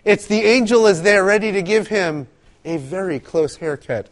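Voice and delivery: ordinary talk, nothing marked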